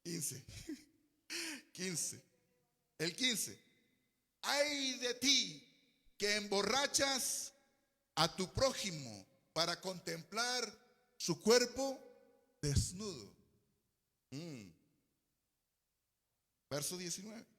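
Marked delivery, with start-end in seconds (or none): 4.4-13.3 exclaimed scripture reading. Real, not acted. Preacher reads verse fifteen to the church, slow solemn warning. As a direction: proclaim it phrase by phrase, slowly.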